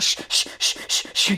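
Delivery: short, rapid-fire shh bursts; quick repeated shushes. Shown as a demonstration. Coach shows parents how not to shush a baby, quick repeated shushes. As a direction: shush in a string of short puffs. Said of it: "If you're doing a shush, you're not".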